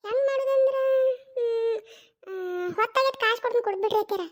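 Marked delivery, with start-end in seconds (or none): 0.1-3.2 mock crying. Daughter-in-law fake-sobs to mother-in-law over tea, whiny. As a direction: sob it out to guilt her.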